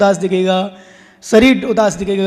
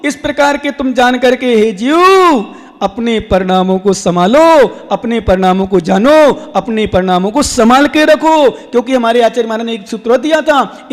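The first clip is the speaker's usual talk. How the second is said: ringing exhortation with swelling line endings; forceful sermon cadence; preaching; 2 semitones higher, 5 dB louder than usual. Real, not acted. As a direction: exhort the congregation, stretching each final word.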